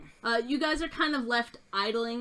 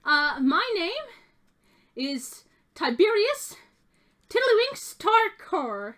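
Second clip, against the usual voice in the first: grand goblin introduction; 11 semitones higher, 7 dB louder than usual. Acted. Goblin introducing himself to the party, high and pompous. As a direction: announce your full title with pomp. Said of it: "and he says".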